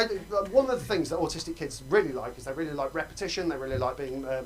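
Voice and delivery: ordinary talk, nothing marked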